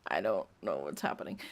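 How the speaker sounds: bewildered whisper, fast; real whisper. Real, not acted. Confused by the fanfic's weirdness.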